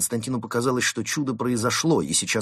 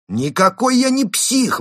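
high, loud, drawn-out protest; indignant exclamation; 13 semitones higher, 7 dB louder than usual. A narrator's reading. Константин denying he is mad, exclaiming loudly. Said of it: "воскликнул Константин".

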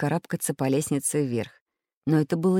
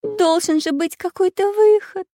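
high, loud tearful plea; 13 semitones higher, 5 dB louder than usual. A narrator's reading. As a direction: plead in a high voice as if starting to cry. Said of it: "заплакала Настенька". (плачущий тонкий голос)

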